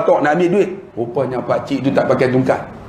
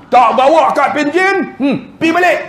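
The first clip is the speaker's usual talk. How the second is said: mock rude clerk; 10 semitones higher than usual. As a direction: brush the old man off with contempt. Kerani menghalau pakcik. (kerani sombong halau pakcik, nada menghina)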